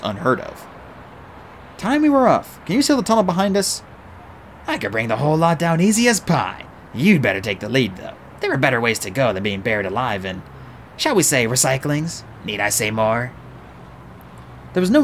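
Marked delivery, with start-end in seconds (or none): 1.8-3.8 put-on higher, hurried boy genius; urgent young voice. Artemis speaking, urgent. 4.7-13.3 rough, earthy dwarf; gruff dwarf voice. Acted. Mulch speaking.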